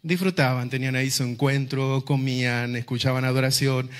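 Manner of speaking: normal talking voice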